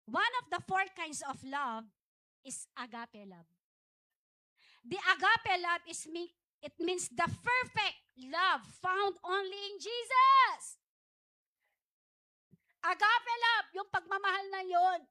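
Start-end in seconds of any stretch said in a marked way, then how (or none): 4.8-15.0 loud, slow preaching; preaching at full force. Acted. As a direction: proclaim agape love from the pulpit. Preaches about agape love.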